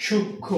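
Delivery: সূক্ষ্ম as "shuk-kho", m left out; silent m. Shown as a demonstration. Teacher giving students the correct pronunciation, silent m. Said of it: "তাহলে উচ্চারণটা কি হবে?".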